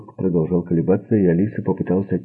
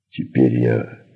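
low threat; 5 semitones lower, slower than usual. A narrator's reading. Man threatens to kill them.

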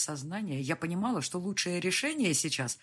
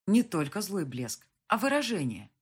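plain reading voice

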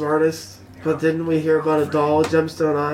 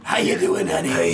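raspy voice. Acted. Player tries a raspy voice.

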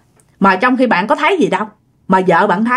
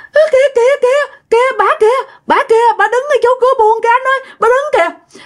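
very high, terrified repeated cries; frightened crying; 14 semitones higher, faster than usual. Retold or imitated. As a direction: imitate a panicked wife urgently pointing something out. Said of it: "vợ bạn sợ hãi mà đang khóc".

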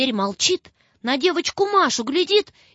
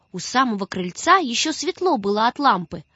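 plain reading voice

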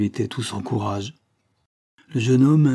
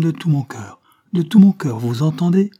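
"De tout mon cœur" repeated much higher and louder; emphatic insistence; 7 semitones higher, 9 dB louder than usual. A narrator's reading. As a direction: drive home "De tout mon cœur" with rising force. Heroine insists on her love for Marianne. (insistance fervente sur « De tout mon cœur »)

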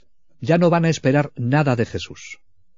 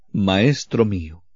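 reverent tenderness; 3 semitones lower, slower than usual. Retold or imitated. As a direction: say it in awe and love, slowly. María reconoce a Jesús. (reverente y lento, lleno de amor)

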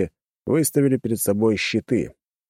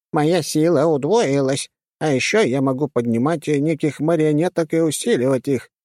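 high-pitched character voice; 7 semitones higher than usual. Acted. Old woman character voice.

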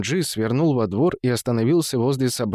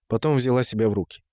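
ordinary delivery

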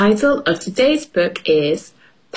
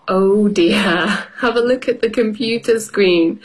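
ordinary delivery, nothing marked

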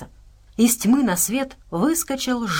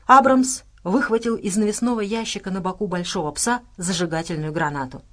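usual speaking voice

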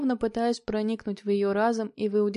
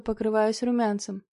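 ordinary delivery, nothing marked

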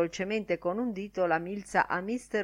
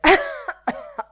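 high, loud acted coughing; theatrical coughing fit; 9 dB louder than usual. A narrator's reading. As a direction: cough loudly and pointedly. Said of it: "Lenihan tossì forte".

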